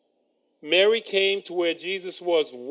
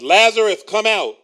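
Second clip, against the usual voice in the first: big loud call, pitched up; shouting; 5 semitones higher, 12 dB louder than usual. Acted. Jesus calling Lazarus out of the tomb, loud shout. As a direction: call the dead man out of the tomb. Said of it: "Then he shouted".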